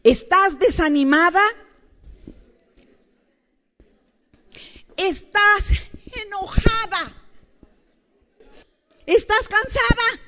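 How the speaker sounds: high, loud questions flung at the crowd; calling out. Real, not acted. Preacher shouting questions at the tired, angry women. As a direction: shout each question to the back row.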